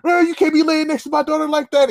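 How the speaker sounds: high, indignant imitation of a dad; scolding father. Father scolding his daughter's boyfriend, acted indignation.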